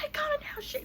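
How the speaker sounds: very high strained squeal of refusal; mock scream, pitch shot way up; retold screaming. Screaming back at him in the argument, high mock scream. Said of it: "we screaming at each other".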